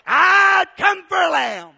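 loud, high, slow proclamation; proud announcement. Acted. Joseph proudly asking the market man for a lamb, loud. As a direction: declare it for the whole market. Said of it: "And Joseph says".

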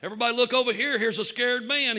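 calling out. Retold or imitated. Preacher exposes the man to the congregation, calling out. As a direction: call the whole room over to look.

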